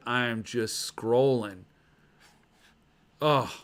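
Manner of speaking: ordinary talk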